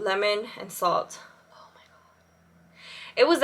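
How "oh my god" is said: whispering. Real, not acted. Savoring a craving memory.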